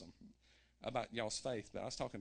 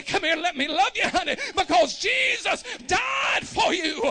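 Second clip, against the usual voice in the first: loving welcome; 11 semitones higher, 13 dB louder, slower than usual. Acted. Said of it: "You're gonna say".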